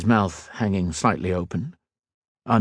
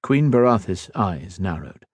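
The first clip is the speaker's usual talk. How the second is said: ordinary delivery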